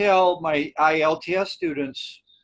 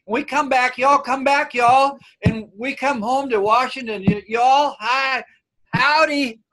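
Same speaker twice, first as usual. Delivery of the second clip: hammed-up Southern y'all, higher and louder; mock Southern drawl; 8 semitones higher, 6 dB louder than usual. Back from Dallas, greeting folks in a put-on Southern accent. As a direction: play the Texan twang you brought home. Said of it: "it's very infectious".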